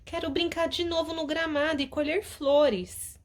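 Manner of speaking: put-on a child begging to play outside; whiny little girl voice; discontented child. Tina speaks to her mother.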